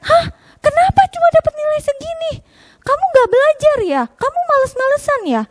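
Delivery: high, loud, mock angry dad; scolding father. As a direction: scold like an upset papa. Father scolding over grades. (papa memarahi dengan keras)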